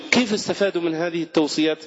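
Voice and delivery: ordinary talk, nothing marked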